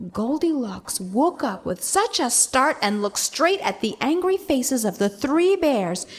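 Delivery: usual speaking voice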